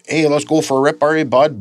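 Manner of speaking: friendly Ontario hoser inviting a buddy along; Southern Ontario "bud". Retold or imitated. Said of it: "the way they say the word bud".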